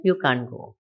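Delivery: low, quiet, unhurried refusal from the master; the guru calmly tells the devotee he can't go, low and settled; calm guru's command. Retold or imitated. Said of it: "Bhagavan Raman Maharshi said".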